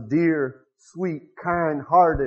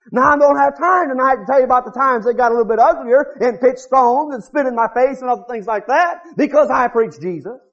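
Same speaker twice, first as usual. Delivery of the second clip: ordinary delivery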